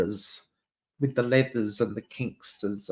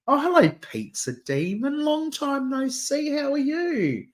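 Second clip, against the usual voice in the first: sing-song, effusive hello, pitched way up; gushing greeting; 12 semitones higher than usual. Acted. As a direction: greet a long-lost friend in a soaring voice. Acted greeting of an acquaintance.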